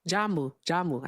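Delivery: Jamu said as zha-MOO; corrected pronunciation. Shown as a demonstration. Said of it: "is how you pronounce it".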